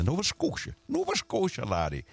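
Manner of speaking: badly faked Scottish lilt, swooping high; terrible Scottish accent. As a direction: put on a hammy Scottish accent. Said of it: "That was a terrible accent".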